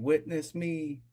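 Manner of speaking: high-pitched battle cry without much volume; mock battle scream. Wishing the captain had charged in, mock high scream. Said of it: "his battleship screaming".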